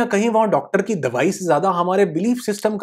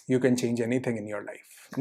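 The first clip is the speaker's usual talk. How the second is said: soft slow emphasis; 6 semitones lower, 9 dB quieter, much slower than usual. Real, not acted. Closing motivational line.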